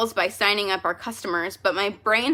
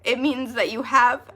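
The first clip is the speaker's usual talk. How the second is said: ordinary delivery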